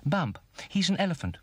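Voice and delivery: high, chirpy bird voice; little bird character. A narrator's reading. Birdie tells the owl about Bump, chirpy. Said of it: "repeated Birdie".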